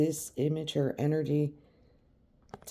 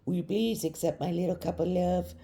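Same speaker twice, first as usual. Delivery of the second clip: higher, timid mock childish voice; childish plea; 5 semitones higher than usual. Imitating a childish querent offering love, higher. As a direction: play a bashful child offering a gift. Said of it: "this sort of childish".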